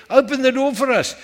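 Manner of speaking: high, loud call to be let in; acted urgent plea. Latecomers begging the bridegroom to open up, acted urgent plea. Latecomers plead at the shut door.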